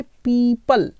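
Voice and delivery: पीपल said as peepal, long ee; correct pronunciation. Shown as a demonstration. Teacher repeating पीपल for students to copy. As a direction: model पीपल slowly, like the peepal tree. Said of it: "कैसा पढ़ना है".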